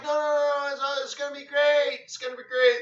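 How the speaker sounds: high, loud, eager mock protest; excited protest. Excitedly protests to laughing sceptics.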